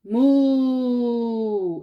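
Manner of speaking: loud, drawn-out cow moo; big stage moo. A narrator's reading. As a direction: moo proudly for the audience. Olivia's big cow moment on stage.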